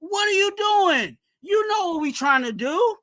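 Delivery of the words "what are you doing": exasperated shout. Real, not acted. Fan begging his linebacker to wrap up, exasperated shout. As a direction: shout it at the player, fed up.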